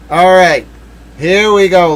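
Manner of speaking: loud, hyped countdown; excited lead-in. Real, not acted. Streamer to chat, loud and hyped. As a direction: pump up the room before the reveal. Streamer hypes up big reveal.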